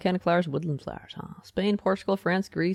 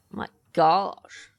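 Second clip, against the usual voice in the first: normal talking voice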